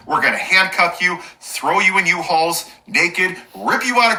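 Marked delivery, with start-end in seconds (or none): none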